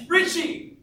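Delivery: high, loud holler; calling out. Real, not acted. Camper keeping up the tradition mid-explanation, high loud call. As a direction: yell it back across the campground.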